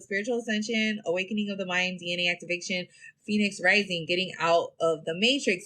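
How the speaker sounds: ordinary delivery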